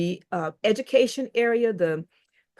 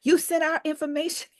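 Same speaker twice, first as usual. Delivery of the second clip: mock upset friend; 8 semitones higher than usual. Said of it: "my group is really upset".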